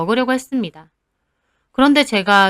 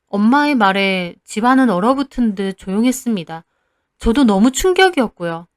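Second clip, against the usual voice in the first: ordinary delivery, nothing marked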